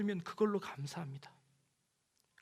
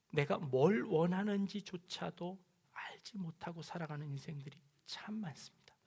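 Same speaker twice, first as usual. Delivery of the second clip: hushed aside; 3 semitones lower, 8 dB quieter than usual. Acted. Preacher drops to a hush.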